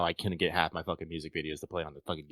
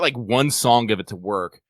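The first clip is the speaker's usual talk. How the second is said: ordinary delivery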